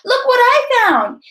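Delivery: high, excited call; shouting. A narrator's reading. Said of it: "return at the end of the day shouting".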